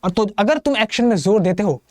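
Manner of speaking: ordinary delivery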